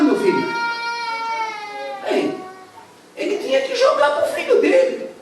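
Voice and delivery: high, loud preaching at full force; fiery preaching. Acted. Preacher tells the congregation the shipwreck story, fiery and loud. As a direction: storm the story out from the pulpit.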